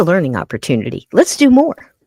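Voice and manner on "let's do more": high, loud and eager; excited. Real, not acted. Streamer urging chat on, loud and high. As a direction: pump up the chat for more.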